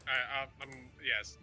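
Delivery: usual speaking voice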